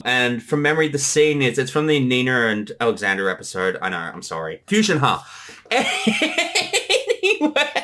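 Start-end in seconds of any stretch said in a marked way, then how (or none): 5.7-7.2 high-pitched embarrassed giggle. Real, not acted. Host giggling at his own joke, high and sheepish.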